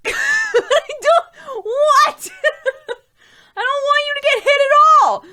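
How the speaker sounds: very high, loud, laughing shout of disbelief; exasperated shout. Retold or imitated. To calm Sam after near miss, high laughing shout. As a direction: yell back at Sam, laughing and outraged. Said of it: "I was just fuming".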